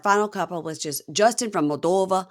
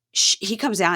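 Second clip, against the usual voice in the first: ordinary talk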